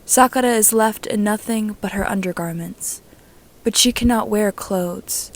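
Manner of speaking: plain reading voice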